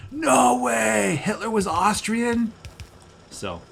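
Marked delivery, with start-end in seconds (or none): 0.1-2.5 higher, louder mock shout of disbelief, slow at the end; astonished shout. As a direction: act out total astonishment. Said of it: "I'm like".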